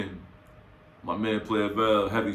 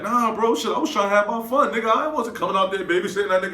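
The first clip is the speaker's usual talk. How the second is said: high defensive friend; 10 semitones higher, 4 dB louder than usual. Retold or imitated. Friend defends himself, voiced high.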